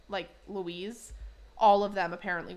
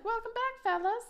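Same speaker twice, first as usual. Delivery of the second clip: high-pitched hostess welcome; put-on high hostess voice; amazing hostess Linda. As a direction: greet them high and sing-out, like a keen hostess. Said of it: "Linda, who is an amazing hostess".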